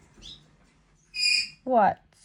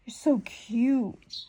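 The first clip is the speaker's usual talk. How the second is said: mock whisper; 6 dB quieter, much slower than usual. Teasing a romantic comic scene.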